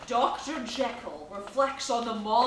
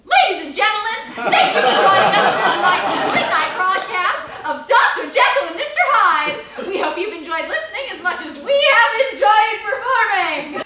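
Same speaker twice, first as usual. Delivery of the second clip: higher, louder, fast broadcast host voice; announcer sign-off; 8 semitones higher, 11 dB louder, faster than usual. Acted. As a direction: close the show with showman cheer. Radio show sign-off.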